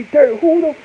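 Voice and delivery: slow, high, loud amazed holler; amazed shout. Retold or imitated. Fan marveling at the lawyer, slow amazed shout. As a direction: shout it out in disbelief. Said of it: "I say".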